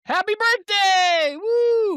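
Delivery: high, loud birthday cheer, drawn out. Real, not acted. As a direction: whoop it up for her birthday.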